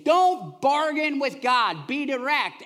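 forceful scripture read; emphatic reading. Acted. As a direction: lean hard on don't. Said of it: "I love the way the Message says it".

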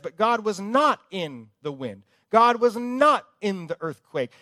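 not in the wind, hammered hard; preacher driving home each not; stress on not. Acted. Preacher to congregation, stressing not in scripture.

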